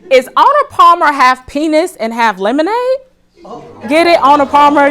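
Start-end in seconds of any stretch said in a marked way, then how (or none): none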